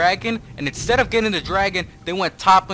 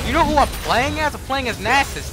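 higher-pitched, incredulous scolding; indignant complaint; 10 semitones higher than usual. Real, not acted. Streamer lecturing Silas about playing Nasus, indignant. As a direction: spell it out to an idiot.

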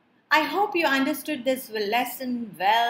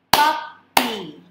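"Puppy" in two loud, separate beats; syllable by syllable; 7 dB louder than usual. Shown as a demonstration. Teacher leading clapping practice, "Puppy" split in two. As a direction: punch out "Puppy" as pup, py. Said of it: "identify syllables by clapping".

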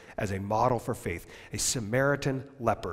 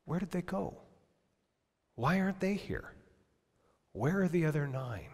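higher, softer, pleading question; sorrowful asking; 4 semitones higher, 6 dB quieter than usual. Acted. Jesus wondering where the others went, higher and sadly soft. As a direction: ask as if heartbroken.

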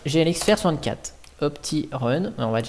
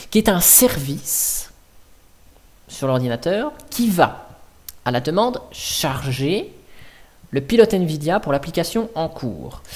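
ordinary talk

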